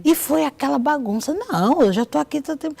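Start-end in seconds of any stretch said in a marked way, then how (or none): 1.3-2.8 high-pitched imitated grumble from the waiting room; retold waiting-room complaint; complaining. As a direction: protest like a patient kept waiting. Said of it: "pessoal tudo reclamando".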